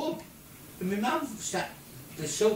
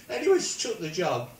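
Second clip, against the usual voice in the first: ordinary talk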